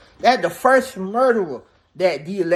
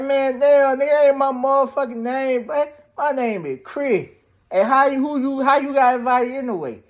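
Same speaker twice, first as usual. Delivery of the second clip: indignant; 8 semitones higher, 4 dB louder than usual. Retold or imitated. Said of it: "that Chris like".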